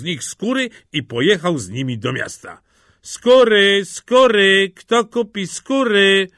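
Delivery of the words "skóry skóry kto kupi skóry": high, loud, drawn-out hawking; sustained peddler's call; street vendor's cry. A narrator's reading. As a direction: call your wares down the street. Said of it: "wołał, idąc ulicami".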